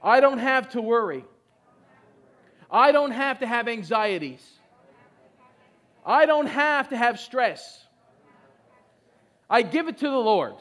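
loud lines for the crowd to repeat; call and response. Shown as a demonstration. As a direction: lead the congregation in a declaration.

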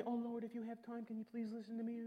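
timid prayer. Pretend. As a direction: pray like you're afraid to bother him. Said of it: "you don't have to be".

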